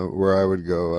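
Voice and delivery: ordinary talk, nothing marked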